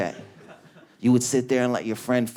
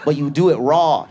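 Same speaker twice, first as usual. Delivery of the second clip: ordinary talk, nothing marked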